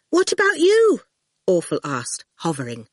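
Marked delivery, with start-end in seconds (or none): 0.1-1.0 worried. Acted. Awful worried.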